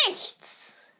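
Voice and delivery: whispered reveal; whispering. Acted. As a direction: breathe the word out. Revealing the answer in a hush.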